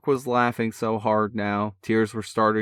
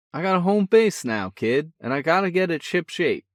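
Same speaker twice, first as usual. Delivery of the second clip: a cocky big brother, full of energy; brash older brother; 7 semitones higher than usual. A narrator's reading. Older brother teasing on the phone, brash and cheerful. Sean voice.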